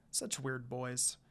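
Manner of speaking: ordinary delivery, nothing marked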